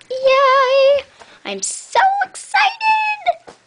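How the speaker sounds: high, slow squealing yay; excited squeal. Real, not acted. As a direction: squeal with delight, drawn out. Squealing about her new clothes.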